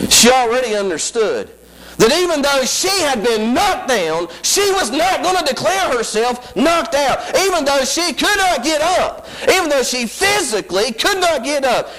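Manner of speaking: high, rising preacher's whoop; preaching at full force. Acted. Preacher whooping to the congregation about her struggle. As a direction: lift it into a sung sermon cry.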